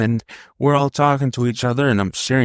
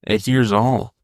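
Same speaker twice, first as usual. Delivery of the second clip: normal talking voice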